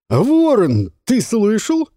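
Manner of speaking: high, loud delighted call-out; excited teasing call. Acted. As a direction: show off to a friend. Жуан зовёт Ворона. (восторженный возглас)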